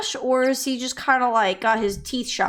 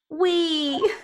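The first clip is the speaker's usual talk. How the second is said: high, drawn-out wee; gleeful cheer; 6 semitones higher than usual. Acted. Child delighted to go to the crime scene, gleeful. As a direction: let out a playful little squeal.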